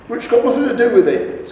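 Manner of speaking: high, loud retort; indignant troll. A narrator's reading. Troll husband retorting to his wife, indignant. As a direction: snap back at the wife.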